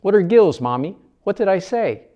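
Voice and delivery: high, loud frightened questions; scared child. A narrator's reading. Dina asking her mother, scared high pleading. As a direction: plead it like a scared little girl. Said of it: "now scared".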